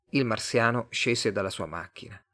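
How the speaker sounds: plain reading voice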